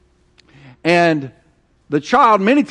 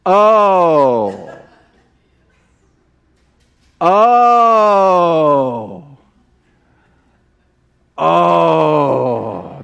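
drawn-out oh, laughing then near tears; the penny drops, laugh turning to a sob; dawning realization; much slower than usual. Retold or imitated. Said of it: "all of a sudden it's like".

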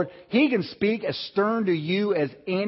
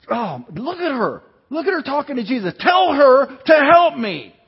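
high, whining complaint that climbs way up and gets louder; mock whiny Martha; 10 semitones higher, 7 dB louder than usual. Scene: Martha complains to Jesus about her sister, high mock whine. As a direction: play the martyr, high and whiny. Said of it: "playing the victim and the martyr".

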